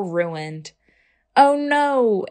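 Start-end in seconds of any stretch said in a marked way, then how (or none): 1.4-2.3 high, playful sobbing cry; a storybook sob, lightly done; mock sob. Ava sobbing over her ruined hairdo. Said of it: "Ava sobbed".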